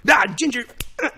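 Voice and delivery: loud, high blurted tic; imitated tic outburst. Man spotting a redhead in a hallway, imitated tic shouts. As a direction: yell it as an involuntary impulse. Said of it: "then he did the same thing".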